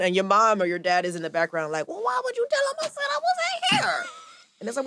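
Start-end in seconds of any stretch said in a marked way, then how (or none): 1.9-4.0 high shrieking parent scold; mock scolding parent. Parent scolding the child from the background, high and shrill. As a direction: shriek at the kid like a mad parent.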